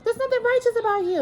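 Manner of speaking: high-pitched imitation of an accusing husband; mock righteous scolding. Acted quote of accusing husband.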